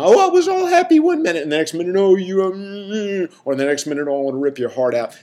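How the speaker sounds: high, hammed-up mock patient, swinging from gushing to threatening; mocking mood swings. To the class, mocking a patient's fake mood swings. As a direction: parody a patient claiming wild mood swings. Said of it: "this is not".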